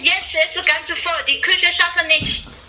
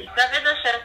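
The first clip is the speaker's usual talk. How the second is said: phone line sound; over the phone; 3 semitones lower than usual. Real, not acted. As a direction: voice through a telephone. Restaurant answers prank call.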